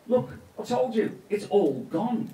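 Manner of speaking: exasperated Charlie. A narrator's reading. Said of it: "said Charlie".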